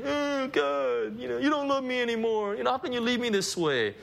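lightly voiced, whining prophet; self-pitying whine. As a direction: Elijah whimpering that God doesn't love him. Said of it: "what's his state?".

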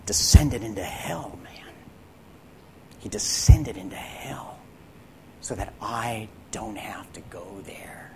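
soft reverent whisper; hushed whisper. Real, not acted. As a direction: whisper it low, in awe. Solemn hushed retelling.

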